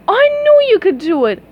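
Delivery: high delighted praise; cheering princess. Acted. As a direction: cheer them on.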